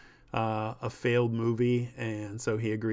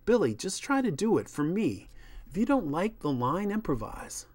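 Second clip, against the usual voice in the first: higher-pitched director calming the room; placating, reasonable director voice; peacemaking director; 7 semitones higher than usual. Acted. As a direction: coax the cast through one last run.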